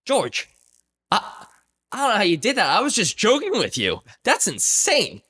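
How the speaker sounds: high, stunned praise, slowing on the last words; a boss who can't believe what he saw; disbelief, dragging out that's insane; amazed boss. Retold or imitated. Boss amazed at George's feat.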